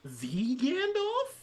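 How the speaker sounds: slow, very high, stretched disbelief; incredulous. Retold or imitated. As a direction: drag out the name in disbelief. Rising disbelief rant.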